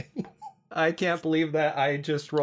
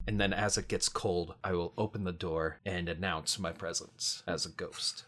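soft, low, slow narration of a creeping chill; hushed low description; 9 semitones lower, 10 dB quieter than usual. Real, not acted. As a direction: murmur it like a ghost story told in the dark. Player describes ghost entrance, hushed.

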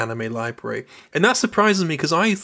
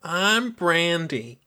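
slow, higher secretary voice; Brandy; 4 semitones higher, 3 dB quieter, much slower than usual. Retold or imitated. Co-host imitating the secretary introducing herself, slow higher voice. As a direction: draw out the name in a put-on voice. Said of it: "She always said her name like that".

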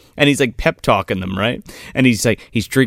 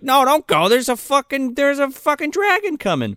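high-pitched mock panic; 11 semitones higher than usual. Co-host imitating Bale warning Natalia off, high mock alarm. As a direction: plead in a much higher voice.

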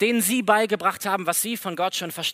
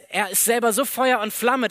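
usual speaking voice

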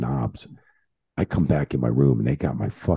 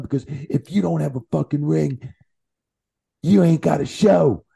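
higher, hammed-up lecturing voice for the boss; imitating Jimmy's lecture; 11 semitones higher than usual. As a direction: play Jimmy laying down the law, pitched up. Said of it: "He's like".

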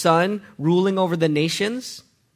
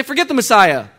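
loud, high, dismissive shouting; defiant rebel; 6 semitones higher, 9 dB louder than usual. Pretend. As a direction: reject the king with open defiance. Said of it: "What if I say".

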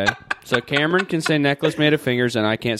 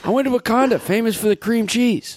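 mock Biden voice; 10 semitones higher than usual. Mock Biden line, high slow.

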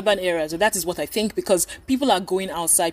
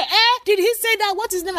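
high-pitched indignant outburst, loud at first; mock outrage, pitched sharply up; retold upset; 10 semitones higher, 3 dB louder than usual. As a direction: imitate an affronted officer demanding answers. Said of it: "The guy got upset".

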